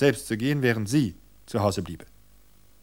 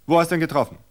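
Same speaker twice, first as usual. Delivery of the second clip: sharp; 7 semitones higher, 6 dB louder, much faster than usual. A narrator's reading. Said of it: "fragte Gerald scharf".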